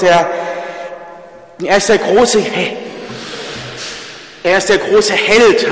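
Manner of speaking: a rising sermon shout full of irony; fervent proclamation. Real, not acted. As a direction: proclaim the almighty hero god with swelling fervour. Preacher mocks longing for hero god.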